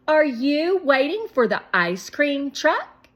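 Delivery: put-on high, loud girl asking; curious girl Claire. A narrator's reading. Claire asking the boys about the truck, high girl voice. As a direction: brighten into a little girl's question.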